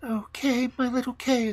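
put-on high, plaintive little-girl lament; a touch of a sob, pitched high; mildly sobbed. A narrator's reading. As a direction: lament in a high child's voice, lightly acted. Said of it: "sobbed Gerda".

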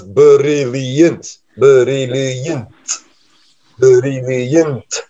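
'brilliant' very slow, broken as bur-il-ee-yent; counted-out syllables. Shown as a demonstration. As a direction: sound out every syllable of 'brilliant', drawn out. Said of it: "an okay way to count it out".

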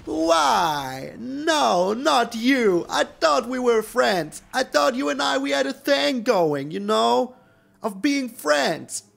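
hammed-up, high wail of betrayal; mock betrayal. Player laments to the Ruin Crab card, in mock heartbreak. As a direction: plead melodramatically with a treacherous friend.

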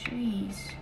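usual speaking voice

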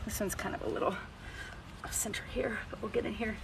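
murmured under her breath, unvoiced; whisper. Real, not acted. Farrier whispering to herself while trimming the hoof.

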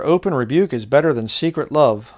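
loud, measured reading of a proverb; solemn preacher's scripture reading. Acted. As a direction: proclaim the verse to the congregation. Reads scripture aloud.

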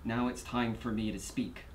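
low, very soft and halting; subdued. Acted. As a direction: begin quietly, weighing each question. Defendant begins courtroom statement, subdued.

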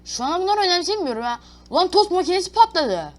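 high, loud alarmed cry; shocked yelling. Real, not acted. Gamer yelling as the toaster explodes, shocked. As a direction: blurt it out as chaos breaks.

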